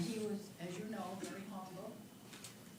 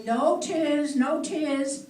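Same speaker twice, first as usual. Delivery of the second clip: mock insistence; 7 semitones higher, 16 dB louder, much slower than usual. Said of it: "Chip said yesterday".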